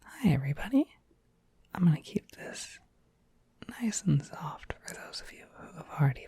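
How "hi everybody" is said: ordinary delivery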